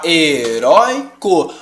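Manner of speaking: "heróico" broken up as he... rói... co; "heróico" stretched into three separate beats; split into syllables. Shown as a demonstration. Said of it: "Se a gente separar".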